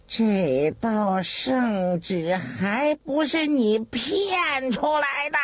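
slow, drawn-out angry accusation, rising high; cursing through gritted teeth. A narrator's reading. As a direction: accuse him bitterly through clenched teeth. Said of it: "咬着牙骂道". (咬着牙骂)

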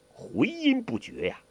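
ordinary delivery, nothing marked

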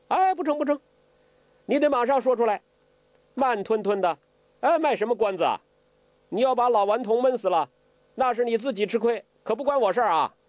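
high, hurried protest; impatient. A narrator's reading. Zhou Botong refuses to wait for Ouyang Feng's answer, impatient. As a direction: press them impatiently, high and fast. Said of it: "周伯通性子急".

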